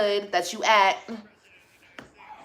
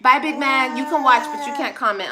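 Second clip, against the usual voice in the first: taunting goodbye; 4 semitones higher, 6 dB louder than usual. Real, not acted. Dismissing hater.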